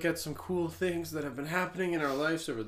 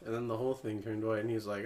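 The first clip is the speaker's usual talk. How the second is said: normal talking voice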